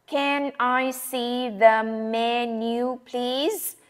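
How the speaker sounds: slow, word-by-word careful request; slow textbook version. Shown as a demonstration. Teacher giving the careful menu request before the real-life one. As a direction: say every word separately and clearly. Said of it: "Nhưng mà trong thực tế thì chúng ta sẽ nghe là".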